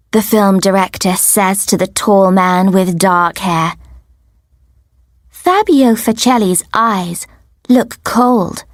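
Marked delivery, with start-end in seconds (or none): none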